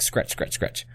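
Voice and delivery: soft whispered muttering to oneself; whispered scratch. Retold or imitated. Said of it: "I was just like".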